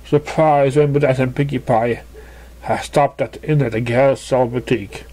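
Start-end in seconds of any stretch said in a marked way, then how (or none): none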